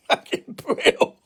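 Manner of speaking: breathless laugh through the words; can barely get it out for laughing; giggling helplessly at the prank. Real, not acted.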